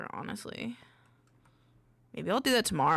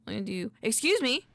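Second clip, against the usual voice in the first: usual speaking voice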